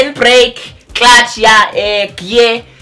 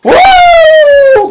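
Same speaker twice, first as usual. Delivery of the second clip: very high, loud cheer of victory; triumphant whoop; 9 dB louder than usual. Retold or imitated. Learner cheering after passing the steep hill, loud whoop. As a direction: let out a joyful woo.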